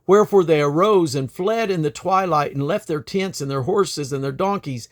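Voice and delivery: lively, dramatic Bible reading; earnest storytelling scripture read. Acted. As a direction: tell the story with feeling, the king and the woman crying out. Reads scripture aloud.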